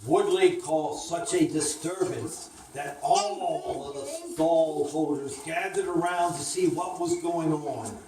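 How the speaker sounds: usual speaking voice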